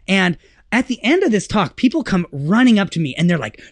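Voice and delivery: ordinary talk, nothing marked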